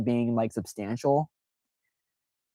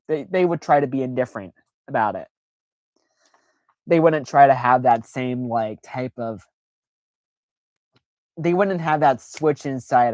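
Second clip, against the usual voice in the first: usual speaking voice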